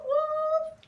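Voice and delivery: ordinary talk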